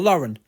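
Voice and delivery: put-on Lauren as a girl's name, LOR-un; simple pronunciation. Shown as a demonstration. Co-host explaining the simple name, Lauren as LOR-un. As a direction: stress the front of Lauren, "LOR-un". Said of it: "Just a girl's name".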